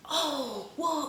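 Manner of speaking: soft, high mock gasp of shock; hushed imitation of a stunned crowd; mock shocked onlookers. Bystanders reacting to her fall, mock shock. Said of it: "everyone around me was just like".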